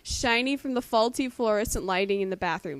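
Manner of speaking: loud, hammed-up dramatic reading. Friend reading a gross fanfic aloud, loud mock-dramatic reading. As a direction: belt out the fanfic like a stage performance. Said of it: "I'm just gonna read this like a woman".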